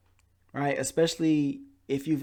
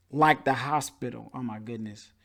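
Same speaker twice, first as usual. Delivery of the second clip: usual speaking voice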